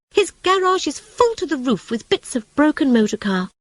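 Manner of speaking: high, fretful rat voice; worried rat. A narrator's reading. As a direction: complain about Toad, pitched high.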